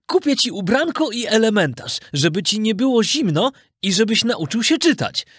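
high, gentle naming of his puppet; name the boy with pride; fond old carpenter. Acted. Carpenter names his new puppet son, fond and high. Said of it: "zawołał majster".

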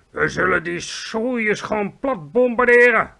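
slow, loud, high military shout. A narrator's reading. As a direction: bark it like a commander. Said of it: "schreeuwde het hoofd van de luchtmacht". (schreeuwend als een generaal)

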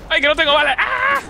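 high panicked yelp; frantic cry, out of bullets; panicking gamer. Real, not acted. Out of ammo mid-fight.

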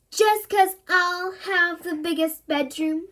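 slow, sing-song gloating; crowing. A narrator's reading. Susie teases Bertie, crowing. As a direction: gloat in a teasing tune. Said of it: "crowed Susie".

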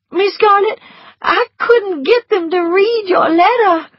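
put-on high, tearful servant girl voice; Prissy near tears with bad news; frightened Prissy. A narrator's reading. Prissy blurting war news to Scarlett, high and tearful.

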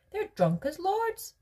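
high, excitable candy maker; Willy Wonka voice. A narrator's reading. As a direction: squeak it out with glee.

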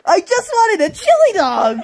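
laughing protest. Acted. Knuckles attacked, laughing protest.

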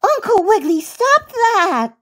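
put-on very high little-girl telling-off; scolding. A narrator's reading. Baby Bunty to wobbling uncle, high-pitched scolding. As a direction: scold him in a small, high voice. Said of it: "scolded Bunty".

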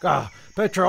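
ordinary delivery

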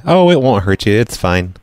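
ordinary delivery

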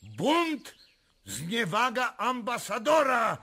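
furious shout. Acted. Stackelberg roars at the king's court in fury. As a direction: bellow orders for soldiers and cannon. Ambassador raging at the Polish court.

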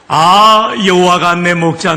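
loud, high Pyongan dialect opening; a bold Pyongan northerner exclaims. Pretend. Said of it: "평안도 버전입니다". (평안도 사투리로 크게 외치듯)

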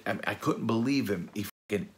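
usual speaking voice

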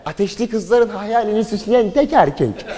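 high, slowed hype reveal; mock announcer. Showing off the boy to girls, hyped announcer voice. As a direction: build it up like a showman.

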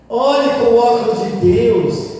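high, loud, drawn-out appeal; loud exhortation. Real, not acted. Priest correcting a quarrelling couple's view, loud exhortation. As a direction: urge them slowly and forcefully. Exhorts, then repeats it softly.

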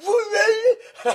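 high squealing "What, man?"; mock angry whine. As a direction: whine it like a mad kid. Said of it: "he would be so mad".

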